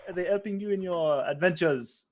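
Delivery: put-on halting question in a loose French accent; Francois. Wizard asking about daggers helping in adventures, halting French accent. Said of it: "This is a Francois accent".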